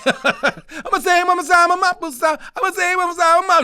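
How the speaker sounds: high falsetto mumble of the lyric; mimicking Michael. Imitating Michael singing to friends, high mumbled hook. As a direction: squeak out the garbled hook like Michael. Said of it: "We don't know what the hell he was saying".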